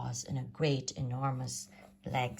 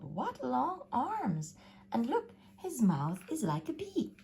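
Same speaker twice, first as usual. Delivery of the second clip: grumbling mother; 8 semitones higher than usual. A narrator's reading. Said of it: "And she grumbled".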